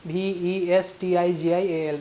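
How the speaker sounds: slow letter-by-letter spelling of वेस्टिजियल; वी ई एस टी आई जी आई ए एल, each letter named slowly. Shown as a demonstration. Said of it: "वेस्टिजियल".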